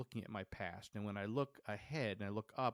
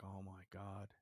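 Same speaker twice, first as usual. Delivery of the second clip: retold hushed dread; 10 dB quieter, slower than usual. Climber looks all the way down, hushed dread. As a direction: drop the volume right down, daunted by the drop. Daunted looking down the pole.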